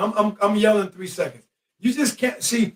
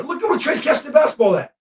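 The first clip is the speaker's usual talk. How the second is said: normal talking voice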